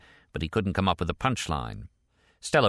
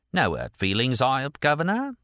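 put-on Cockney, climbing high on "Governor"; phony Cockney, ironic; 6 semitones higher than usual. A narrator's reading. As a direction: try for irony in a fake Cockney accent. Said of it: "falling back into his phony Cockney accent and trying to sound ironic".

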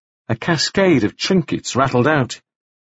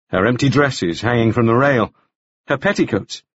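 ordinary delivery, nothing marked

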